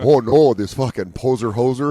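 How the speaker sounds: loud mock Canadian dismay; mock Canadian crowd. Edmonton crowd spots a poser, mock Canadian accent. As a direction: grumble like an affronted Canadian local. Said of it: "he's talking like us now".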